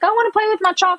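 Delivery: put-on high pleading child voice; little son. Son asking to play with chalk, high pleading voice. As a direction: beg like a small boy wanting to play. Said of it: "he's always like".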